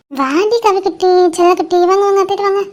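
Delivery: tender, doting sing-song to a little one; cooing baby talk. Acted. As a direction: coax a baby into your arms. Aunt coaxing a baby over.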